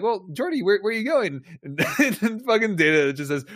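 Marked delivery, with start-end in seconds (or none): 0.0-1.4 female engineer. Retold or imitated. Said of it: "And she's like".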